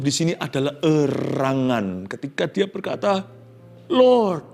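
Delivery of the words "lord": flehender Ausruf, höher und lauter; weinend. Acted. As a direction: ruft zu Gott wie unter Tränen. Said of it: "dia menangis".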